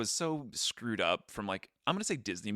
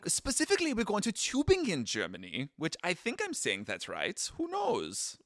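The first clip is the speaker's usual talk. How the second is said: higher-pitched, bouncy German accent; mock German accent; 7 semitones higher than usual. Shown as a demonstration. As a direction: put on a playful German accent. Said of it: "do the whole episode like this".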